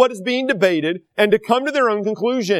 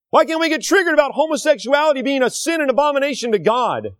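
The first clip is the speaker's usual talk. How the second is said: higher, insistent repeated questions; indignant preaching; 6 semitones higher than usual. Real, not acted. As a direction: raise the voice with each question. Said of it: "It really makes me mad".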